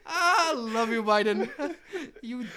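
high laughing, almost crying. Real, not acted. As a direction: laugh through the words. Laughing hard at Biden.

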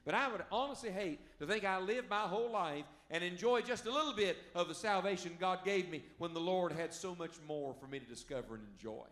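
ordinary talk, nothing marked

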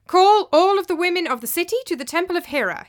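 high, loud order; imperious summons; commanding. Acted. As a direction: proclaim the order to be obeyed. Tyrant gives an order.

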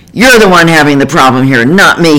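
fast, furious yell throwing the blame back. Retold or imitated. Husband yelling at the therapist, furious and defensive. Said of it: "he yelled".

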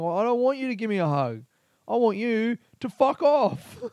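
put-on imagined comeback to a drunk heckler, shouted; angry retort. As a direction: snap back at the heckler, rising in anger. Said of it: "That made me really angry".

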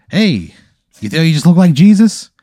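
high, loud, over-cheery announcer; TV promo host. Acted. Mirror screen lights up to greet a diner, chirpy announcer. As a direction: belt it out like a screen advert. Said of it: "a little T V screen on the mirror lights up".

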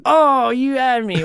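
startled, drawn-out relief; caught out. Real, not acted. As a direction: react like you just got fooled. Fooled by fake "not recording.

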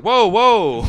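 loud, high startled whoa; mock alarmed whoa. Listeners react loudly to his praise, startled. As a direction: throw up a loud warning. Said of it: "everyone's like".

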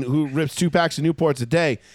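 normal talking voice

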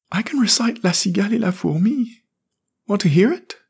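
high, eager schoolboy voice; proud little boy. A narrator's reading. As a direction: show off like a third grader. Jamie boasts.

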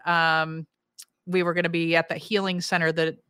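usual speaking voice

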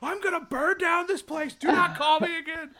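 hammed-up furious woman; imitating furious threat. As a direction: act out an enraged caller threatening. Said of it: "she's just cursing out".

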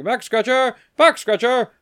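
high-pitched hawker's call; cartoon vendor. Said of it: "he's selling back scratchers and he's like".

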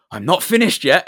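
loud, high mock teacher outburst; Colin. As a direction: imitate an indignant teacher cutting someone off. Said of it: "And Colin was like".

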